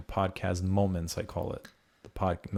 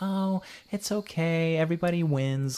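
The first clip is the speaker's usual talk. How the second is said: everybody-wins mentality; 9 semitones higher than usual. Acted. Parent comforting a losing child, mocking indulgence. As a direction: coo it like an over-lenient parent. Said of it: "kind of mentality".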